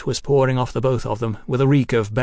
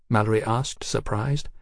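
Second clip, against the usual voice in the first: ordinary delivery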